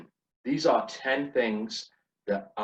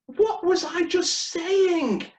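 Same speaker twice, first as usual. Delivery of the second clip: loud, very high, drawn-out yell; exasperated shout; 6 dB louder, slower than usual. Retold or imitated. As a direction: shout it slowly in mock outrage. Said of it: "I felt like shouting".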